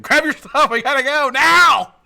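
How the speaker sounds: high, very loud yell, drawing out the end; urgent mock shout. Man ordering Veronica to flee, urgent shout. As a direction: reenact a panicked order at full volume.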